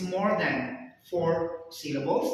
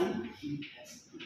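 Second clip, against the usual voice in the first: echoed whisper; 6 semitones higher, 9 dB quieter, faster than usual. Real, not acted. Teacher echoes the whispered answer.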